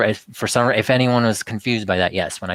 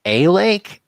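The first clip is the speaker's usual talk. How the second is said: 高了一截的惊讶反问; much higher, louder, a playfully incredulous "A lake?"; mock surprise; 11 semitones higher, 5 dB louder than usual.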